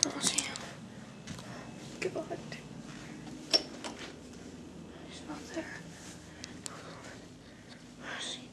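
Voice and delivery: hushed scared whisper; whisper, hiding. Acted.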